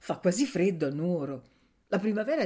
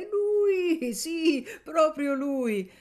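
much higher, loud astonished cry of a woman; exclaiming; 11 semitones higher, 4 dB louder, much slower than usual. Acted. Woman at her door spotting the returning student, exclaiming loudly. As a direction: call it out in amazement, much higher. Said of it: "sente esclamare".

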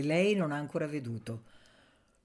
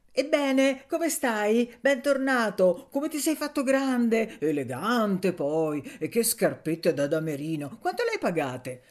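joyful greeting; 5 semitones higher, 4 dB louder than usual. Acted. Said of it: "comincia a far gesti di gioia".

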